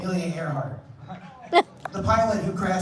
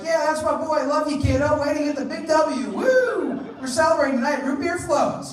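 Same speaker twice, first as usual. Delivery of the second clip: mock proud dad; 9 semitones higher, 6 dB louder than usual.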